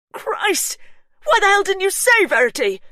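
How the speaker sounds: high, very loud yell; husband yelling at sister in rage; furious shout. A narrator's reading. Hugh furious.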